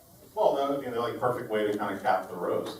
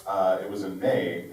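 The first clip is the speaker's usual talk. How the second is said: ordinary talk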